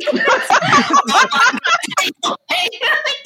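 loud, high cackle that keeps going; real uncontrolled laughter; long high laughing fit. Real, not acted. Recalling the robot weekend on the podcast, loud high laughter.

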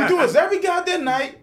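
very high, strained holler; high-pitched fed-up shout; shouting at neighbors. Pretend. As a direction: yell through the wall in exasperation. Shouting at arguing neighbors through wall.

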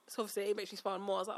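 ordinary talk

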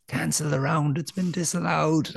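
high, slow, put-on announcer call; high drawn-out mock announcer voice; imitated pub announcement.

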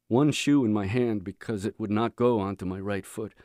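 plain reading voice

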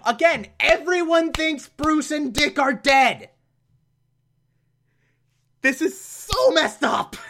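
high-pitched exasperated disbelief; appalled outburst. Real, not acted. Reviewer appalled that everyone thinks the heroes are dead, exasperated outburst. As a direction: protest it in a high, incredulous voice.

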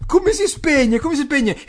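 acuta e agitata; signora confusa. Retold or imitated. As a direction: chiede in panico come spegnere. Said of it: "di nuovo col ditone". (signora anziana in panico col telefono)

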